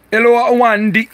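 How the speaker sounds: very high, loud put-on voice; playful put-on greeting. Streamer greeting a viewer by name, silly high voice.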